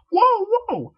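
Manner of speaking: very high, loud whoa; startled whoa. Acted. Rainbow backs away from the raging Miss Cake. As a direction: back off in alarm.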